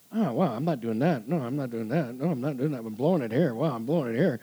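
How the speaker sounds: rueful self-realisation. Retold or imitated. Husband reading marriage books, lilting self-reproach. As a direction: list your own failings in a repeating lilt.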